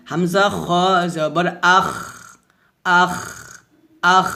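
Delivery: thick scraped kh with air in আখ; full-mouthed আখ ending in long friction; heavy hams. Shown as a demonstration. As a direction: round the খ and let breath rasp on. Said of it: "খটা মোটাও করতে হবে".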